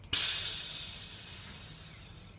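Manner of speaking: long, fading hiss of escaping air; imitated tire hiss. Storyteller imitates the popped car tire, long hiss. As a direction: let the air out with a voiceless hiss.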